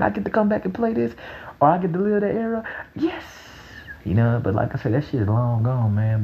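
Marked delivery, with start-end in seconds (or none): 0.0-3.2 quick and high with excitement; excited. Real, not acted. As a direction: rush it out, thrilled. Excited about replaying era.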